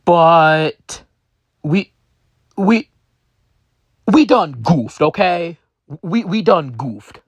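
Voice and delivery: put-on high, loud Russian accent; a sheepish Russian businessman admitting fault. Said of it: "said in his Russian accent".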